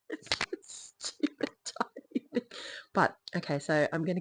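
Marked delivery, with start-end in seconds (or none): 0.1-2.4 whispered aside; breathy whispered aside. Real, not acted. Aside about tiny microphone.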